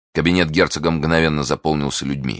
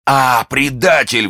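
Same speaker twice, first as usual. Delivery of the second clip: high, drawn-out accusation; cry of betrayal; 8 semitones higher, 4 dB louder, slower than usual. A narrator's reading. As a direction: cry out against the traitor. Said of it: "вскричал Бекингем".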